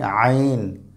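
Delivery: আইন squeezed from mid-throat, long vowel; pressed throat sound. Shown as a demonstration. Teacher models Arabic letter, pressed throat sound. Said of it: "চাপ দিয়ে পড়তে হবে".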